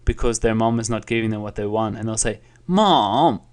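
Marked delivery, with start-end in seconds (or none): 2.7-3.4 whiny drawn-out, higher dip and rise; a child protesting to mom; high, swooping tantrum whine. Acted. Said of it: "throwing a tantrum".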